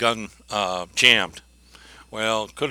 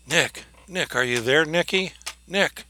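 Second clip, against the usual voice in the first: higher, anxious calls for Nick; frightened calling out; 6 semitones higher, 4 dB louder than usual. Retold or imitated. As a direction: call into the dark basement, scared. Girl calling down dark stairs.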